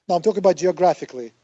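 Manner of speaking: usual speaking voice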